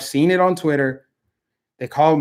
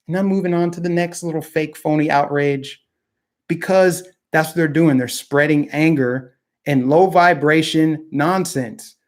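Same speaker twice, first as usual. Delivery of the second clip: ordinary delivery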